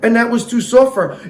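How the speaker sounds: normal talking voice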